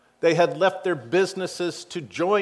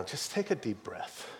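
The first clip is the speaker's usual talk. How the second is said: soft, calm reassurance; softly calm someone down; Jesus softly calming the preacher; gentle Jesus; 3 semitones lower, 12 dB quieter than usual. Retold or imitated. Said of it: "like he's telling me right now".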